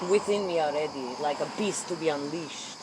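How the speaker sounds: normal talking voice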